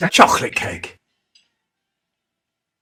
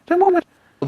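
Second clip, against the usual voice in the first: high whiny grumble; sulky kid muttering back; a mock sulky whine; whiny sulk; 14 semitones higher, 5 dB louder than usual. Sulking child.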